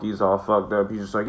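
ordinary delivery

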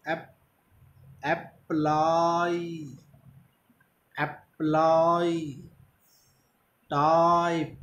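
British "रिप्लाई", "अप्लाई", slow, with a long आ; British English "टाइप", drawn out slowly, the ई light. Shown as a demonstration. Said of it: "आ पर stress रखना है, ई पर हल्का सा". (British उच्चारण में "स्टाइल", धीमा, आ पर ज़ोर)

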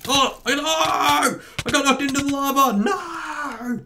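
high panicked wail; dismayed cry. Real, not acted. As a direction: cry out as you fall. Knocked into lava.

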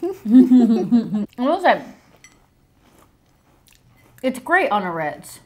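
talking through food; mouth full, chewing. Real, not acted. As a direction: hand over full mouth. Seen 2: Left woman bit food earlier, covers mouth while talking; speaker unsure.

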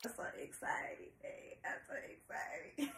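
very soft, whispery aside; hushed excitement. Real, not acted. Opening a gift sent by Showtime, hushed excitement. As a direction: murmur it almost under the breath.

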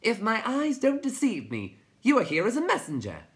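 loud, high little creature voice; chirping. A narrator's reading. Tiny addresses the newcomer, high loud chirp. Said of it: "and chirped".

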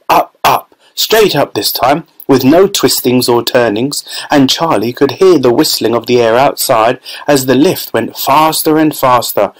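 ordinary delivery, nothing marked

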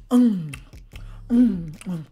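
low mm of enjoyment; savoring hum. Retold or imitated. People eating her food, low savoring hum. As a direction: hum like tasting good food.

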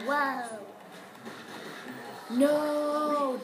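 loud exasperated wow; raging gamer. Real, not acted. Reacting to score, louder.

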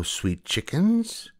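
very high, very slow coaxing; wheedling witch. Acted. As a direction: draw it out sweetly like a hag. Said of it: "she called".

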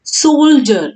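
"सोल्जर" as SOHL-jer, first syllable held high, then falling; teacher demonstrating "सोल्जर" as two syllables, SOHL-jer; pronunciation model. Shown as a demonstration. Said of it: "इसका उच्चारण कैसा होता है".

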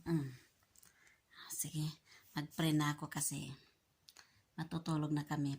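soft whisper at bedtime. Real, not acted. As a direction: whisper it quietly. Whispering before sleep.

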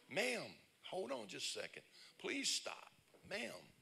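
high, pleading calls; man calling after her. Retold or imitated. Stranger chasing a woman in Walmart, high pleading call.

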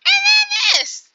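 high, loud, slow outburst of disbelief; yelling at the empty yard in disbelief; exasperated shout. Real, not acted. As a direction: throw it out in exasperation.

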